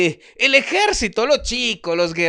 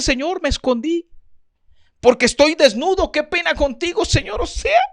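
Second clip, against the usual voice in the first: high, embarrassed excuse-making voice; sheepish Adam; 8 semitones higher than usual. Acted. Adam caught hiding, answering God in a high sheepish voice. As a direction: squeak out the excuse like a caught child.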